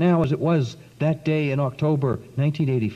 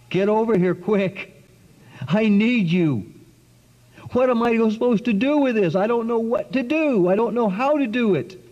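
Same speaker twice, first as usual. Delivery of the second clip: desperate plea; 6 semitones higher than usual. Retold or imitated. Desperate prayer for help.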